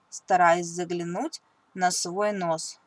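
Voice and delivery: plain reading voice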